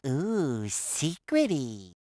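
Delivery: low, drawn-out conspiratorial ooh; slow, hushed relish of a secret; mock-mysterious. Character hinting at secret bonus items, mock-mysterious.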